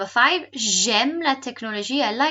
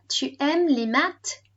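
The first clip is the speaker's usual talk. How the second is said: slow, higher questioning French, rising at the end; slower; 5 semitones higher, 4 dB louder, slower than usual. Shown as a demonstration. Teacher repeating the recorded question for pupils, slowly. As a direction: ask it slowly for learners. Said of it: "And slower".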